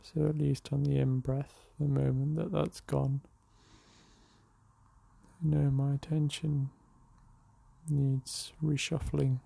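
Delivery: unhurried meditation voice with long pauses; guided meditation. Real, not acted. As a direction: guide them gently into the breath, leaving space between phrases. Leads a morning meditation.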